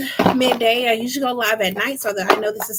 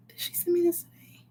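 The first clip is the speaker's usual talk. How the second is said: quiet unvoiced aside; whisper; 7 semitones higher, 10 dB quieter than usual. Real, not acted. Checking a PayPal donation, whispering to herself.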